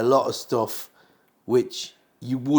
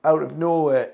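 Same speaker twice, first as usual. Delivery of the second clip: ordinary talk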